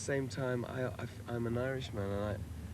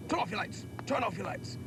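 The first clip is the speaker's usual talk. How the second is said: put-on barked command in a well-to-do British accent, higher; posh British accent; 11 semitones higher than usual. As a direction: order it like a posh British officer. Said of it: "this sort of British accent, sort of quite a well-to-do accent".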